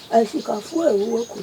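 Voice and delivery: ordinary talk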